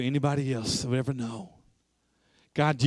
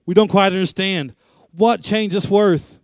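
raised, puzzled crowd voice; crying out; 6 semitones higher, 6 dB louder than usual. Acted. Puzzled crowd at the auction calls out, raised voice. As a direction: call it out, higher and louder. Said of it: "some of them, they cried".

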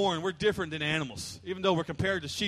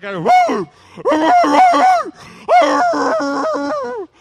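loud, much higher howling roos; bay like a dog stuck outside at night; dog barking; 13 dB louder, much slower than usual. Retold or imitated. Pastor imitates backyard dog barking its head off, shouting high.

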